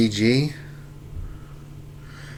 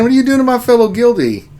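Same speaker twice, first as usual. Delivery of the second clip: high, loud, fast laughing scold; playful guild-defending rebuke; mock outrage; 12 semitones higher, 9 dB louder, faster than usual. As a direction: tease the chatter with fake indignation. Mock scolding a chat member.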